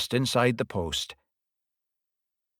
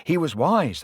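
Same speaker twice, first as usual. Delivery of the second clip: plain reading voice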